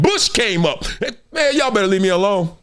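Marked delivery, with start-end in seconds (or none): none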